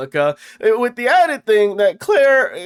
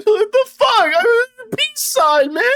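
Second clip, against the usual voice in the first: laughing disbelief; 4 dB louder than usual. Real, not acted. Laughing disbelief after retold quarrel.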